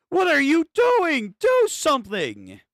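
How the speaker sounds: high, loud, drawn-out shout; exasperated yell. Acted. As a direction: yell at them to act. Yells at characters to act.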